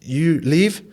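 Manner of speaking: lightly put-on foreign accent, rising; imitating a cleaner with broken English; a hint of a foreign cleaner asking. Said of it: "broken English".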